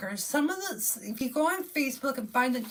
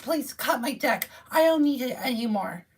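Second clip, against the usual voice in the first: normal talking voice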